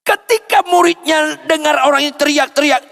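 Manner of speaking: high, loud sermon cry; impassioned, ringing preacher; preaching at full force. Real, not acted. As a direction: exhort the congregation from the top of your voice.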